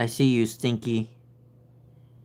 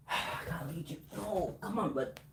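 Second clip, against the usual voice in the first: fast hush, much softer; whispered coaxing; 3 semitones higher, 14 dB quieter than usual. Real, not acted. Owner whispering as she leads her cat. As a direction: murmur to lead him along.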